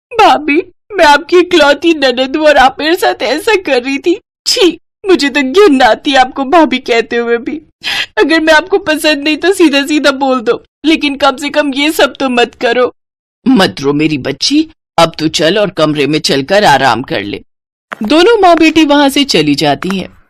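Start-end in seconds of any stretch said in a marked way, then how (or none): 0.1-12.9 higher, whiny wounded reproach; fake-hurt sister-in-law. Kavya fake hurt accusation. 13.5-17.4 calm the upset daughter; tender mother. Acted.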